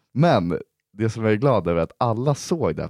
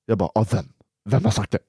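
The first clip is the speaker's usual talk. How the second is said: ordinary talk, nothing marked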